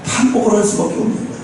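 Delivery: normal talking voice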